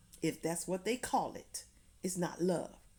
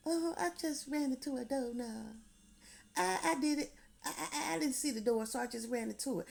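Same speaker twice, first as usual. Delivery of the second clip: high, halting put-on voice of a victim covering up a bruise; victim making excuses; 6 semitones higher, faster than usual. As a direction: stammer out the doorknob excuse, pitched well up. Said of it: "and say".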